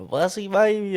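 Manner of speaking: playful dad cooing at his fussy son; baby talk. Retold or imitated. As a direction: coo it like teasing a baby. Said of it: "So I would always go like".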